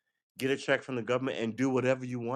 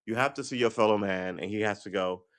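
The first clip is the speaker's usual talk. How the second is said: ordinary delivery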